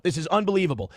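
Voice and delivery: ordinary delivery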